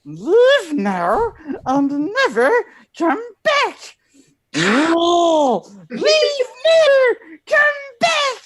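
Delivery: high, screaming creature voice, slowly drawn out; Smeagol. Acted. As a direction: build to a very high, loud cry of banishment. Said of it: "I'm gonna do the impression now".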